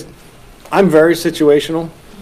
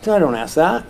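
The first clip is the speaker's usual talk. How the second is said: normal talking voice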